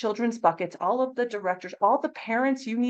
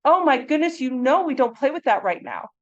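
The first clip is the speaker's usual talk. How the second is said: raised, higher voice in an acted telling-off; mad scolding; 5 semitones higher, 6 dB louder than usual. Teacher losing patience with children at play, mad scolding. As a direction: scold with exasperated force, louder and higher. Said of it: "Let's say we do get mad".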